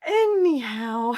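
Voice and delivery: long, high, drawn-out "Anyhow"; sassy slow high "Anyhow". Shown as a demonstration. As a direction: sing it out breezily, dismissing the fear. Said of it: "and you smile and you go".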